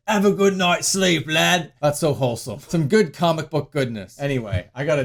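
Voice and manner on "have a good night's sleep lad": workshopping a British catchphrase; put-on British lad voice; mock British accent. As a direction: try the line in broad British. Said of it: "What am I gonna say?".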